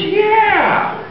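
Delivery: very high, delighted Irish cry; hammed-up high Irish brogue; drunk Irishman. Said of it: "the Murphy twins are drunk again".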